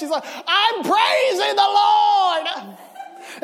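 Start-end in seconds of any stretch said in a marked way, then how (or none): 0.5-2.5 high, slow, shouted cry of praise; joyful praise shout. Retold or imitated. Mother shouting praise to the Lord while dancing, joyful slow shout. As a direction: belt it out in ecstatic joy. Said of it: "she just is screaming".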